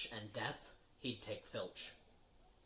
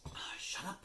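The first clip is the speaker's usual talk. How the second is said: urgent whisper. Acted. Hushing Peeves in the dark corridor, whispered. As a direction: hiss it unvoiced. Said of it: "hushing Peeves at night".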